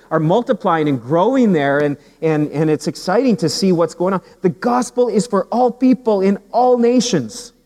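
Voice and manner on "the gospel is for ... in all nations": high, emphatic proclamation; raised, ringing pulpit voice; preaching at full force. Acted. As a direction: proclaim it to the congregation.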